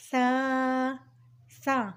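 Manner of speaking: سا with a soft lisped th, as in "think"; soft pronunciation. Shown as a demonstration. Teacher introducing the letter to learners, سا softly lisped. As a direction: say سا gently, softly th not hissed s. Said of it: "نرمی سے پڑھنا ہے".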